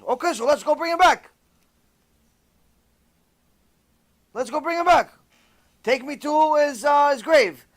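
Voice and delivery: high, pressing call to go; eager insistence. Acted. Rabbi presses his students to take him to the grave, eager. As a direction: urge everyone out the door now.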